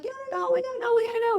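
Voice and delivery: nervous, panicky self-doubt; anxious inner voice. Acted. The worried inner voice nagging her, in a high panicky tone. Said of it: "every time that voice is like".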